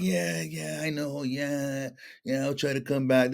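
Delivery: quiet, noncommittal yeahs; mock vague brush-off. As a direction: shrug them off half-heartedly. Kids' vague brush-off reply.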